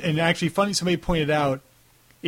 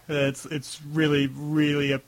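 usual speaking voice

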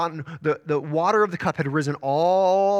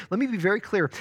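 usual speaking voice

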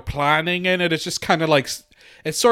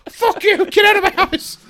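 very high-pitched yell; hammed-up screeching fury; mock outraged shriek. Song's creator throwing out his critic, mock outraged shriek. Acted angry reply to song critique.

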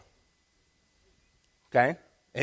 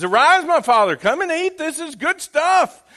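Jacob acting out; 12 semitones higher, 8 dB louder than usual. Jacob voiced to father Isaac.

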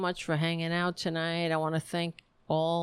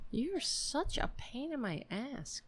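soft scolding; 5 semitones higher, 10 dB quieter than usual. Real, not acted. Talking to the cat.

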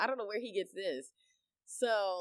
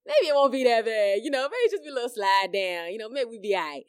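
nervous self-talk; 3 semitones higher, 8 dB louder than usual. Retold or imitated. Scared mom on the slide, hoping out loud. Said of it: "So I'm like".

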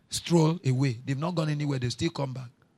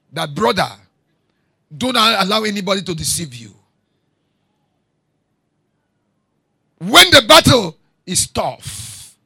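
higher and louder, driving pulpit cadence; full-force preaching; 5 semitones higher, 9 dB louder than usual. Acted. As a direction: challenge the congregation from the pulpit. Preacher challenging congregation.

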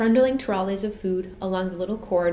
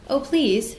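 higher, pleading call; urgent but polite appeal; calling after; 5 semitones higher, 4 dB louder than usual. A narrator's reading. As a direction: call out to stop someone leaving. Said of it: "Jane called after him".

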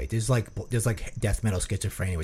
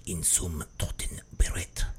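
soft, slow, sinister whispering in German; creepy German whisper; 5 semitones lower, 4 dB quieter, slower than usual. Shown as a demonstration. Demonstrating scary German whispering to co-host, slow creepy whisper. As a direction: whisper it softly and menacingly slow. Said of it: "when they whisper creepily".

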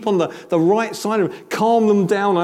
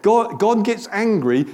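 usual speaking voice

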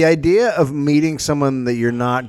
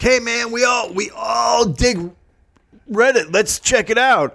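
high-pitched, drawn-out mock enthusiasm; mock eager meetup fan; 11 semitones higher, slower than usual. Reddit user rallying a meetup, mock eager. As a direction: parody an over-keen online fan, pitched way up.

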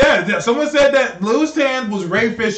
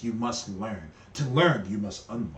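much lower and softer, solemn proverb; wise old master voice; 13 semitones lower, 13 dB quieter than usual. Retold or imitated. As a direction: intone it like a kung fu sage. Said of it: "all of them speak like this".

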